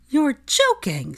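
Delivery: incredulous scoff. Acted. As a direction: scoff in disbelief. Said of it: "scoffed Hermione in disbelief".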